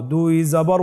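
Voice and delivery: ordinary delivery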